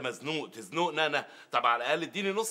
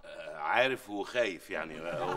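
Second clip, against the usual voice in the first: mock fear; 11 semitones lower, 6 dB quieter, slower than usual. Mocking the rival's threat.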